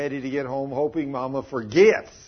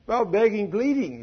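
begging tone; 7 semitones higher, 4 dB louder than usual. Retold or imitated. Describing son crying.

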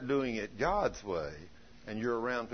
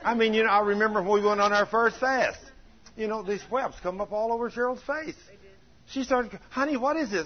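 preaching at full force; 9 semitones higher, 5 dB louder than usual. Acted.

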